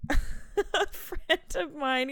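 usual speaking voice

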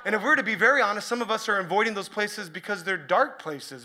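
ordinary delivery

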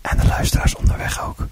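breathy ASMR whisper. Shown as a demonstration. Doing ASMR for the podcast, whispering to Bart. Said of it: "Zo'n funzig fluisterprogramma".

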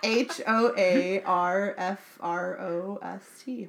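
slow letter-by-letter spelling, laughing; spelled out. Shown as a demonstration. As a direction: spell each letter out slowly through a laugh. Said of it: "did they say hoarfrost".

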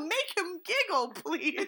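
high, slow begging; lightly voiced pleading host. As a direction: plead sweetly. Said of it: "And they're like".

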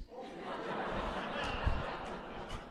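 a high airy laugh at his own punchline; high breathy laugh. Real, not acted. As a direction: let a quiet laugh slip out after the joke.

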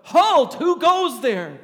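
full-force guard's shout, stretched slow; loudest yell. Retold or imitated. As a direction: roar it out like a soldier ambushing from behind a tree. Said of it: "in the loudest voice I could, I yelled out".

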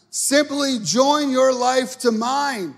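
high, loud, drawn-out proclamation; preaching Jesus' words. Acted. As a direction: call it out slowly at full preaching pitch. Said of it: "He says this".

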